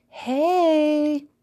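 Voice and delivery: high, loud squeaky sister voice; squeaky greeting. A narrator's reading. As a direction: squeak out a drawn-out hello. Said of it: "her sister's squeaky voice greeted her".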